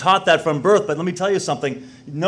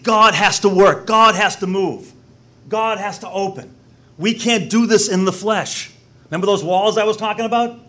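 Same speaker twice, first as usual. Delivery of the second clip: higher-pitched, emphatic sermon delivery; impassioned preaching; 6 semitones higher than usual. Real, not acted. Preacher insisting only God can do it, impassioned preaching. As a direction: drive each declaration home to the congregation.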